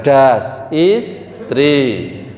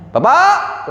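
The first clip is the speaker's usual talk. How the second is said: sharp, high rising "Bapak!"; disliked wifely intonation; 11 semitones higher than usual. Shown as a demonstration. Wife calling her husband, disliked tone. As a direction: call out to a husband with a steep upward jump. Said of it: "Tapi intonasinya".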